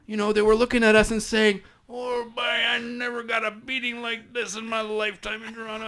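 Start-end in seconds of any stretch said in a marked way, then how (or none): 1.9-5.9 put-on East Coast accent. Beaten Newfoundland guy complains to the officers, high East Coast accent. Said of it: "my East Coast accent".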